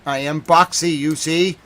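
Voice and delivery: ordinary talk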